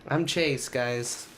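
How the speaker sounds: normal talking voice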